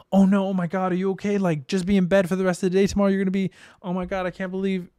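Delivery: high, fast fussing panic; mock a fretting overprotective friend; overdramatic worrier. Imitating someone catastrophizing over a panic attack, high and fussy. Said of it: "If somebody, like".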